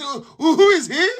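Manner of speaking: high, puzzled mock question; mock puzzled Briton. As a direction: play the clueless foreigner asking. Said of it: "the man asked".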